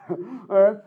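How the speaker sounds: ordinary talk, nothing marked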